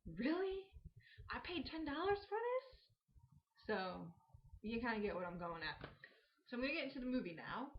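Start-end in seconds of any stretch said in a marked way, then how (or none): 0.1-2.9 high, disbelieving squeak; can't believe you paid for this, pitch it way up; incredulous. Retold or imitated. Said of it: "you're like".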